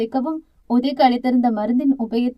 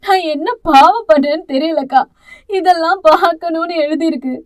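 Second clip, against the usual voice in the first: tearful, higher lament; crying; 3 semitones higher, 6 dB louder than usual. Acted. Mother crying to her sister about her son, tearful. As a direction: sob out the complaint. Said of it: "ஒரு மூச்சு அழுது தீர்த்தார்".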